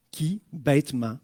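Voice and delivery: ordinary delivery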